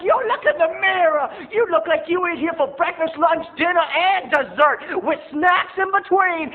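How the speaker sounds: high, loud, slowing roast; sassy clerk. Retold or imitated. Clerk roasting mom, high loud sass. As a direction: drag it out mockingly. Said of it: "He's like".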